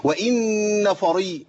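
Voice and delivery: وإن فري drawn out slowly; slow demo. Shown as a demonstration. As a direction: stretch فري before the qaf. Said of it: "فري إيه؟".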